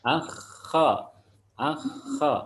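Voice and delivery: "Akha" with long upper-throat friction; kha demonstration. Shown as a demonstration. Teacher giving pupil a model, "Akha" with drawn-out KH. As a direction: scrape the KH and open into A on "Akha". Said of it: "Huruf kha, coba".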